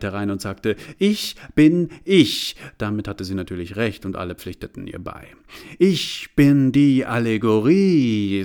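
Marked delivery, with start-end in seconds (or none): 1.0-2.6 high, loud, slow proclamation; solemn self-declaration. Acted. Self-reference declares itself to everyone. 5.8-8.4 loud, slow and self-important; pompous declaration. Acted. Allegory introduces itself to the bowing crowd.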